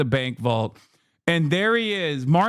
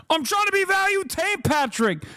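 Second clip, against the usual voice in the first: mocking squeal of a repeated line; high mocking whine at Patrick; 12 semitones higher than usual. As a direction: pitch it way up, teasing. Mocking host's mispronunciation.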